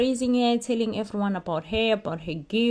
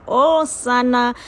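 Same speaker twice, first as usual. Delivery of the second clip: excited gush; 4 semitones higher, 9 dB louder than usual. Real, not acted. Gushing over the wedding proposal.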